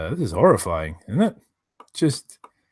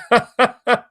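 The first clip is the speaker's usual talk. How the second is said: high laugh; 11 semitones higher, 5 dB louder than usual. Real, not acted. Laughing at own joke.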